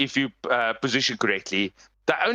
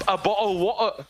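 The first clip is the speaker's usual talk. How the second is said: put-on English accent; 6 semitones higher than usual. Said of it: "why I don't like English people".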